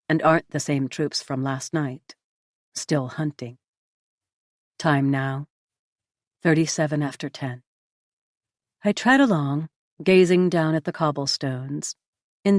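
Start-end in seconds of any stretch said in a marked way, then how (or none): none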